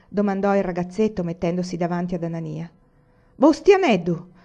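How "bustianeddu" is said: high, loud shout of a name; angry call. A narrator's reading. Miller yelling at his meddling son, sharp shout. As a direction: yell the boy's name in warning. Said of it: "gridò il mugnaio".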